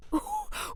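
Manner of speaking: very high, soft giggle; high squeaky giggle. Acted. Bruce giggling at himself. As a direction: let out a tiny squeak of a giggle. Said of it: "with a giggle".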